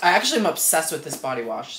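ordinary delivery

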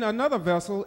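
ordinary talk, nothing marked

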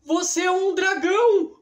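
high, loud alarmed exclamation; shocked cry. Acted. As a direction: blurt it out in shock. Wilson realizing it's a dragon.

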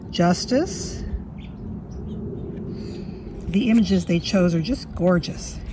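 ordinary talk, nothing marked

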